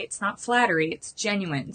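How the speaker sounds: ordinary delivery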